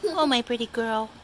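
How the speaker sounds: high cooing voice for the cat; doting owner baby-talking her old cat; pet baby talk. Acted.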